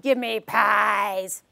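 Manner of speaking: low, slow, deliberate voice; low demanding kid. Shown as a demonstration. Samuel demands pies from the judge, low and slow. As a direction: plant your feet and demand it low and slow. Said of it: "We did the high-low".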